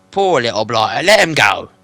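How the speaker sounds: loud troll voice. Acted. William repeats.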